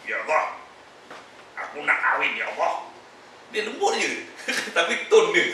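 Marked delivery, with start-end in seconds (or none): 0.1-2.9 soft but harsh tone. Shown as a demonstration. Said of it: "Dia cakap lembut, perlahan je tapi".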